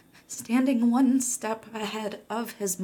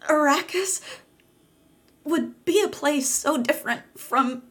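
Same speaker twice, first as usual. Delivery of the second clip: straining excitement; 8 semitones higher, 4 dB louder than usual. A narrator's reading. Narrator's voice strains high, loud.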